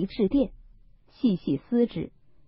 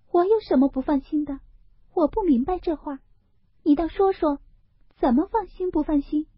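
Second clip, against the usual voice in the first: put-on much higher, fast, insistent young lady's question; Daiyu pressing him; 9 semitones higher, faster than usual. Daiyu to Baoyu, demanding what he means, higher and quick. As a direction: make him spell it out.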